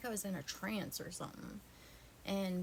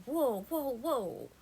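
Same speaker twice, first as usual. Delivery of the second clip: stunned whoa; 5 semitones higher, 8 dB louder, much slower than usual. Retold or imitated. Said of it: "I said".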